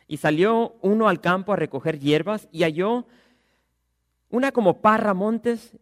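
loud, projected Bible reading; scripture reading. Acted. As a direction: proclaim the passage to the whole congregation. Reads the scripture aloud.

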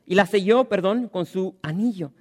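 loud, ringing Bible reading; scripture reading. Acted. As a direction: proclaim the text to the congregation. Reads scripture aloud.

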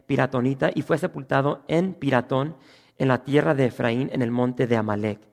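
formal reading of the Bible text; scripture reading. Acted. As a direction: read the verses out to the church. Reads scripture aloud.

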